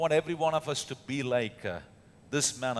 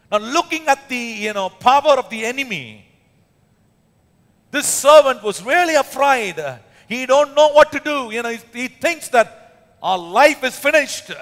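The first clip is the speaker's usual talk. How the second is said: high, loud, driving preacher's voice; preaching loud, rising; preaching at full force; 9 semitones higher, 9 dB louder than usual. Acted. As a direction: build the fear of the servant, loud. Preacher building on the servant's fear.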